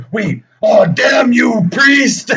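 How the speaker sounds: high, loud hammed-up devil shout; mock devil cry. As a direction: yell it like a beaten movie demon. Devil defeated by priest.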